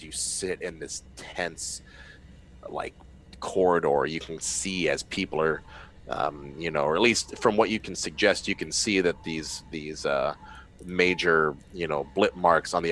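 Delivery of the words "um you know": ordinary talk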